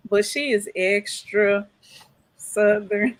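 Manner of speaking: "extra" drawn out slowly and leaned on; emphasize "extra" before "Southern"; stress on extra. Shown as a demonstration. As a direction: hit "extra" hard, slow down on it. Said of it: "Let me emphasize the extra".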